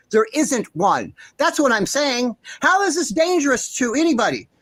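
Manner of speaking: ordinary delivery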